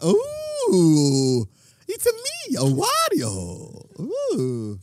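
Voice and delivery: high squeaky Wario impression. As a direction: ham up Wario, slow and drawn out. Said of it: "bring [name] along as Wario".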